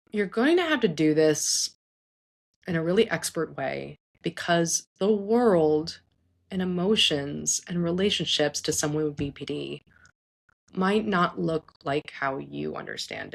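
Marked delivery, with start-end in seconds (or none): none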